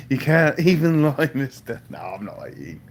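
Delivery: ordinary talk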